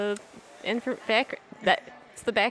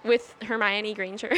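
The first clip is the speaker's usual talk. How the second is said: ordinary talk, nothing marked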